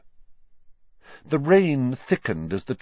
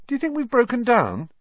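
worried. A narrator's reading. Worried in the dark.

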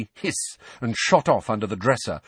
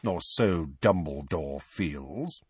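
slower, softer, lower aside with restrained discontent; slightly flattened tone; 5 semitones lower, 5 dB quieter, slower than usual. A narrator's reading. Sirius on what the headmaster feels, slightly flattened. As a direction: let displeasure with the headmaster show faintly. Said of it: "slightly flattened tone of voice".

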